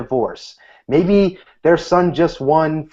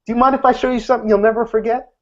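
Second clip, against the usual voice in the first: higher and fast, teasing pitch; enticing offer; 10 semitones higher, faster than usual. Shown as a demonstration. Magician offers the table a trick, quick and eager. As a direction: hook them with a quick, eager promise. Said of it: "the next thing he says is".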